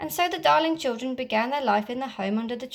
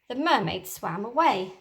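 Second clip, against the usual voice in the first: plain reading voice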